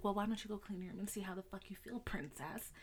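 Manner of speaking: hushed, fast imitation of mom; whispered mock mom. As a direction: whisper mom's snarky line quickly. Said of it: "And she's like".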